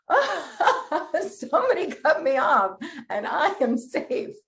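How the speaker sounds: laughing all the way through, high. Shown as a demonstration. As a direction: giggle out the affirmation. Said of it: "Now say it laughing".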